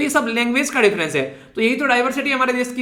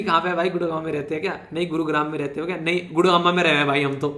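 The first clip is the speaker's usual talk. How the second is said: a hint of rustic Haryana-side talk, lower and softer; local Gurgaon speech; 5 semitones lower, 5 dB quieter than usual. Teacher mimics Gurgaon locals for students, light rustic Haryana-side imitation. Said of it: "इस तरीके से बोलते हैं ना".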